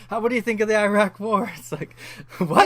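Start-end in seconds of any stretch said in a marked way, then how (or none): 0.1-1.5 loud, higher mock interviewer question. Retelling the Chappelle bit, a loud mock interviewer. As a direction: ham up a newsman asking a rapper.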